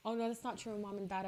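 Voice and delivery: ordinary talk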